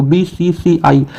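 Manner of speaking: बीसीसीआई spelt out slowly, letter by letter; बीसीसीआई, one letter at a time. Shown as a demonstration. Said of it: "हर एक word को individual पढ़ रहे हो".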